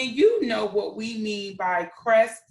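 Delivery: usual speaking voice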